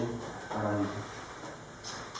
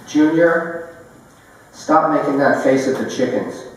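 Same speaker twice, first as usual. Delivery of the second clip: raised-pitch call on the name, then scolding; calling out a reprimand; 5 semitones higher, 10 dB louder than usual. Acted. Someone tells Junior off about the chickens, calling out.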